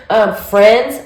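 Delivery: ordinary talk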